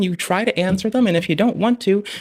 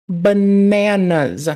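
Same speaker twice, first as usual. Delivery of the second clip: stretched-out disbelief; 4 semitones higher, 5 dB louder, much slower than usual. Real, not acted. Reacting to advice.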